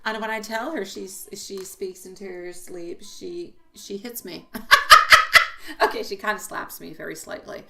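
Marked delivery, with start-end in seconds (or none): 4.5-5.8 high, loud burst of laughter; real laugh. Real, not acted. Mother amused at her daughter's slaps, laughing.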